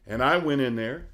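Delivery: ordinary delivery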